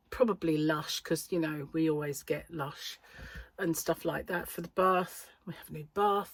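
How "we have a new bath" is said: ordinary delivery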